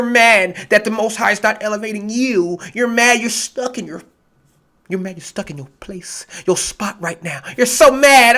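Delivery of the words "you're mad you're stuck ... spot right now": ordinary delivery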